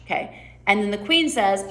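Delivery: usual speaking voice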